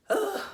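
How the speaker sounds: high, loud groan of annoyance; frustrated groan. Real, not acted. Speaker regretting her lost lesson recordings, frustrated groan. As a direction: let out a sharp ugh at yourself.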